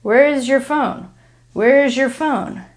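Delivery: "where is your" blended into "wherezher"; connected Z plus Y. Shown as a demonstration. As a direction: join "where is" and "your" with a zh sound. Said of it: "this Y turns into a zh".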